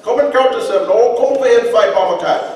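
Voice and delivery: loud, high playground taunt; calling out to fight. Retold or imitated. Kid daring cousin Mama Cat to come fight. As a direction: yell the dare across the yard. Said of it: "cousin calling out".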